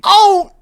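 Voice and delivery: very high, loud "Oh"; loud sudden cry of pain; pained yelp. Retold or imitated. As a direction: cry out as if your eye was hit. Acting out eye getting hit.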